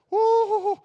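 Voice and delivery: high, loud startled whoo; mock whoo of fright. Preacher acting his fear of heights at the canyon rail, loud whoo. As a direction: yelp like you're leaning over a cliff edge.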